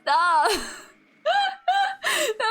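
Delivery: high-pitched helpless laughter; laughing so hard it almost breaks. Real, not acted. Laughing fit at Chad's joke.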